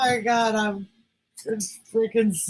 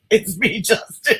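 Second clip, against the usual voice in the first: imitating Justin; 5 semitones higher, 4 dB louder than usual. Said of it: "and he says".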